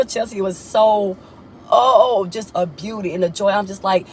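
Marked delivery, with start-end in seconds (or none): none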